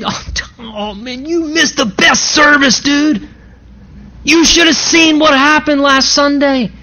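high, eager friend blurting out the news; excited disciples. Acted. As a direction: gush about the best service to a friend. Disciples excitedly telling Thomas.

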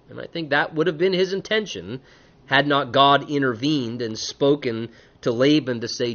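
ordinary talk

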